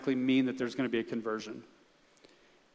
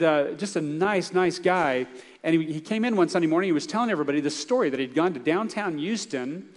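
ordinary talk

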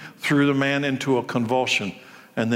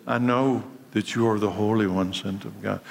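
a lower, darker demon voice; 5 semitones lower than usual. Retold or imitated. Preacher voicing the demon who recognizes Jesus, low and dark. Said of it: "The demon says".

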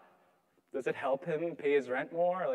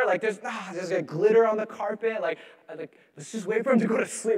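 normal talking voice

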